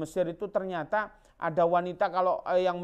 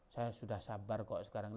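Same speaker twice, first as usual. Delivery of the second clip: meek late excuse; 6 semitones lower, 11 dB quieter than usual. Pretend. Imagined person claiming patience late.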